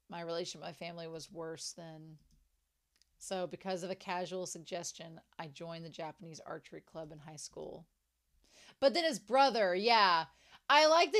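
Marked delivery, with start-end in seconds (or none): 0.1-7.9 low, soft recital; reading aloud. Acted. Fan reading a character's family backstory aloud, low and soft. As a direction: read it out quietly and low.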